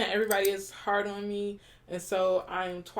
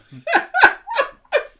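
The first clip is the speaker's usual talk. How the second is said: burst of laughter; 15 dB louder than usual. Real, not acted. Laughs after being praised.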